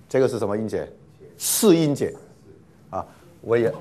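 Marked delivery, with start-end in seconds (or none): none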